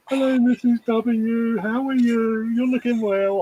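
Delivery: high, loud, over-polite greeting; Kevin and Perry. Retold or imitated. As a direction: lay on mock charm for a mate's missus. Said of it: "it's Kevin and Perry with you".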